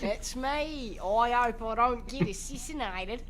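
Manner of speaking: hammed-up Australian accent as a president; Australian JFK impression. Joking on a podcast, an Aussie JFK in a hammy Australian accent. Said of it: "Australian John F Kennedy".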